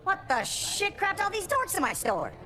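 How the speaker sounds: high, drawn-out indignant cry; outraged, high and slow; appalled outburst. Acted. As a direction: wail it in disbelief. Shocked at crowd in store.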